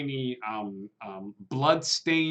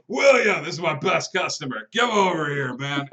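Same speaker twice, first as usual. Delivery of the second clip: much higher and louder greeting for his best customer; jolly owner shouting; 3 semitones higher, 7 dB louder than usual. Acted. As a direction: call out across the diner, arms wide. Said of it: "He's like".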